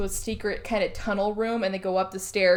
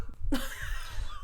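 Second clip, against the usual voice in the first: wheezy silent laugh; 9 dB quieter than usual. Real, not acted. Laughs at forgetting the word.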